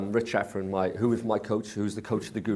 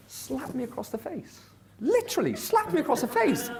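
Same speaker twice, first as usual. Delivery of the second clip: pitched up high, stunned; excited high retelling; 12 semitones higher than usual. Retold or imitated. Telling the room how Jay slapped him down, high and excited. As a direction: tell it wide-eyed and high.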